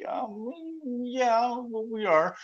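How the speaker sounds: imitating a high, hedging admission; reluctant admission. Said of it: "it was like pulling teeth out of 'em".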